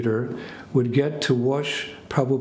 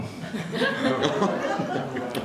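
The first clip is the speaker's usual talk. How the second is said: short amused laugh, trailing off; real laugh; 5 semitones higher, 4 dB quieter than usual. Real, not acted. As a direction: laugh at your own mischief. Laughing at the stolen key.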